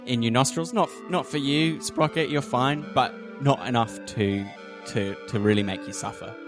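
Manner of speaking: ordinary delivery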